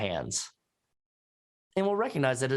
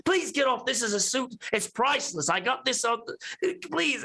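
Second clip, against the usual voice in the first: whiny fop; 11 semitones higher than usual. Acted. Foppish gentleman begging Ross to get off, high and whiny. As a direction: beg to save the suit. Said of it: "He goes".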